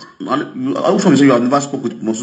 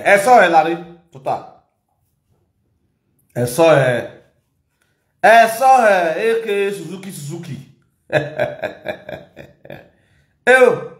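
hype shout-out; 5 semitones higher than usual. Acted. Host greeting his people on a live, hyped MC shout-out. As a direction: hype up the viewers like an MC.

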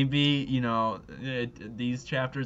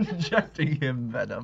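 laughing; 4 dB louder than usual. Real, not acted. Reading the line through laughter.

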